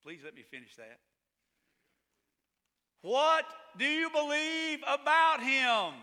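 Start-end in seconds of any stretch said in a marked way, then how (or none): 3.0-5.9 slow, higher and louder question; demanding slow question; pointed challenge. Real, not acted. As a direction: lean in and drag it out. Pastor challenging congregation.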